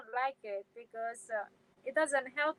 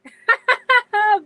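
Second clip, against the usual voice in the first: loud real belly laugh; big laugh, much higher and louder; burst of laughter; 9 semitones higher, 18 dB louder than usual. Real, not acted. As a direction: crack up at the joke. Laughs at viewer's joke.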